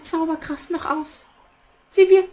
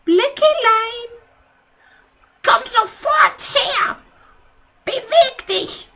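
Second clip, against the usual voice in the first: bossy summons; 5 semitones higher, 4 dB louder, slower than usual. Acted. Witch commands her raven.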